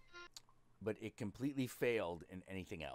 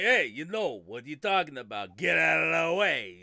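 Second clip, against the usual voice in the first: high, loud Brooklyn tough guy; loud hammed-up Brooklyn voice; mock Brooklyn accent; 7 semitones higher, 14 dB louder than usual. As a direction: play a pushy Brooklyn wiseguy. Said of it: "it's like".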